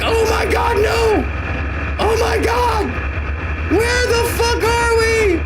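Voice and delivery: very high yelling cat, panicking; squeaky panicked kitty yelling. Retold or imitated. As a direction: shriek it as a terrified little cat. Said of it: "He's like".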